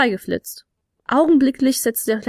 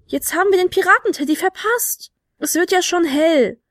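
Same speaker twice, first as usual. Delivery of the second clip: higher, dismayed complaint; disappointed; 7 semitones higher than usual. A narrator's reading. Flute player missing the carousel, dismayed and disappointed. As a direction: lament the missed chance in a raised voice. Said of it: "stimmte Fionella an die Enttäuschung mit ein".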